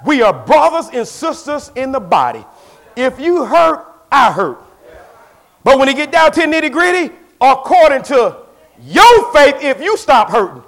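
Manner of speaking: loud, high pulpit cry; rising sermon shout; full force preaching. Acted. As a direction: preach it out with fire.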